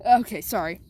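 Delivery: plain reading voice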